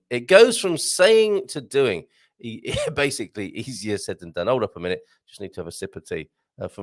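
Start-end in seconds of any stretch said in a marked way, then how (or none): 0.1-2.0 slow translation. Shown as a demonstration.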